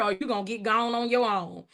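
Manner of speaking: usual speaking voice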